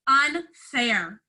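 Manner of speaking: usual speaking voice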